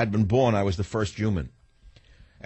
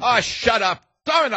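real fed-up shout, much higher and louder; angry shout; 12 semitones higher, 4 dB louder than usual. Real, not acted. Host shouting down the clip caller in anger. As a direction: yell it at the radio in disgust. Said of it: "I can't stand him".

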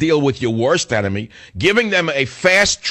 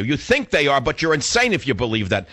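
normal talking voice

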